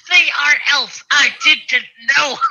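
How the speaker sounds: high, loud mock protest; hammed-up, high-pitched wail of innocence; ham guy. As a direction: cry it out high in comic outrage. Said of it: "imagine the ham guy going".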